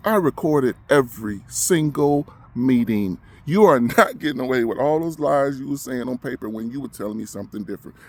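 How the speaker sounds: loud, hammered declaration; real anger, leaning hard on each word; defiant accusation. Real, not acted. Patient warning doctors that the meetings were recorded, defiant.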